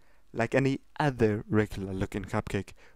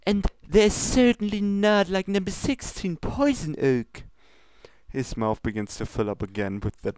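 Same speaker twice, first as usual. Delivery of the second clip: high, formal princess scolding; lofty royal reproach, pitched high; 6 semitones higher than usual. Acted. Luna scolds Pinkie about her ingredients, high and prim. Listing cupcakes.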